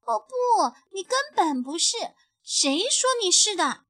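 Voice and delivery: high, loud, fast and unconvinced; a little sister refusing to believe it; indignant protest. A narrator's reading. Little bunny protesting to her big brother, indignant and high. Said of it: "小兔子不服气地问道".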